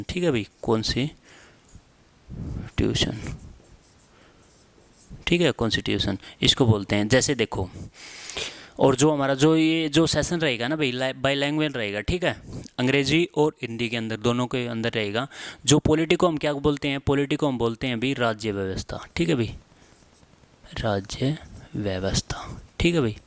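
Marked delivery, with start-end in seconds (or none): 0.6-3.2 कौन सी tuition split by a long pause; broken-up dictation. Shown as a demonstration. Teacher dictates term in parts. 20.8-22.6 राज्य व्यवस्था slowly, almost whispered; slow, hushed dictation. Shown as a demonstration. Teacher dictates term for writing.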